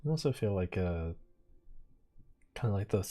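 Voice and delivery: usual speaking voice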